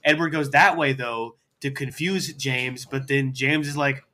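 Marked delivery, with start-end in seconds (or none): none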